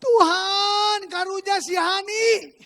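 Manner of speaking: loud, high, drawn-out wail; hammed-up pleading yell, stretched slowly; crying out to God. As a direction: re-enact a desperate shouted prayer. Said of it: "Saya cuman ngagorowok ke Tuhan, teriak sama Tuhan".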